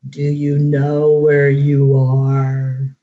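low, slow booming voice from the dark; a voice from hell, slowed and low; thundering demon voice. A narrator's reading. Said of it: "a voice thundered".

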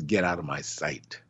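God banishing the sinner, hushed and low; God's judgment. Acted. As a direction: dismiss him in a low hush. God voiced, dismissing sinner.

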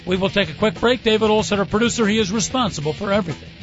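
usual speaking voice

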